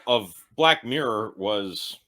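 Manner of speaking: normal talking voice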